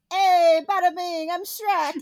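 loud, high, brash Italian-American impression; Shrek. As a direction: belt out a deliberately bad Italian accent. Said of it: "this is me doing my Shrek im-, impression".

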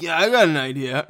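loud stoner-dude voice; James Wan. Acted. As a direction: play him as an eager, loud stoner. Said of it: "I'm doing a stoner accent".

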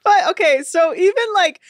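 words through laughter; laughing. Real, not acted. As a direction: laugh as you talk. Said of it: "laughing while talking".

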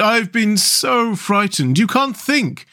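high, drawn-out plaint after a scare; high-pitched, distressed little character; frightened cry. A narrator's reading. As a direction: pour out the fright to a friend. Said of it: "he cried".

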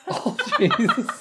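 high, slow laughing exclamation. Real, not acted. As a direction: gasp it out through laughter. Laughing at the [name] joke.

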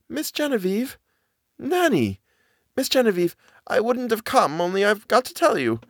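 high, urgent servant voice; a scared nanny rushing to warn her mistress; frightened old nanny. Acted. Nanny blurts her warning to Miss Genevieve, high and urgent.

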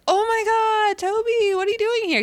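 high, loud thrilled greeting; excited. Retold or imitated. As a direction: squeal with happy surprise. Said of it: "He's super stoked".

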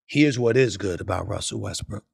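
usual speaking voice

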